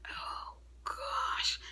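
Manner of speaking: hushed, voiceless "oh gosh"; whispered dismay. Retold or imitated. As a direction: sigh it out in a whisper of dread. Acts out dread at picture.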